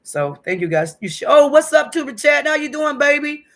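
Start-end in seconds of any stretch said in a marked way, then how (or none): 1.3-3.4 loud, high-pitched, delighted hello; excited greeting. Real, not acted. Streamer greets Tuba Chat arriving mid-talk, excited. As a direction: shout out a big welcome.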